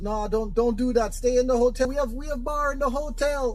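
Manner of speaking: high-pitched Jamaican woman's voice; Jamaican accent. Acted. Hotel receptionist warning a guest to stay in, Jamaican accent. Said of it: "I'm gonna do my Jamaican accent".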